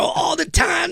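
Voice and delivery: mock church shout; church response shout. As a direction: shout it like a joyful congregation. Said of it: "I go".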